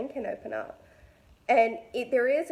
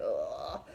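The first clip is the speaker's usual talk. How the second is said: low, drawn-out groan of fatigue; weary groan; 13 semitones lower, 6 dB quieter than usual. Retold or imitated. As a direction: let out a deep, fed-up groan. Weary day, at a loss.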